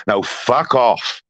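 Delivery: drawn-out, cold fuck off; slow it right down and spit it; slow final dismissal. Real, not acted. Closing his rant at Smiler, slow and cold.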